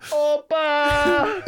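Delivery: high, loud cartoonish accent; hammed-up foreign shout; mock Italian-Greek voice. Answering his cohost as Giancarlo, shouting high in a hammed-up accent. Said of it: "I think that's Greek".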